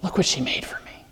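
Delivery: whisper. Retold or imitated. Widows mourning, voiced quote.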